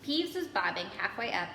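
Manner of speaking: usual speaking voice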